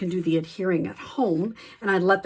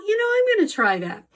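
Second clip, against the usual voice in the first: quoted thought jumps much higher and faster; eager thought; 11 semitones higher, much faster than usual. Retold or imitated. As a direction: replay the sudden "I'll try that" excitement, quick and high. Retelling her own eager thought.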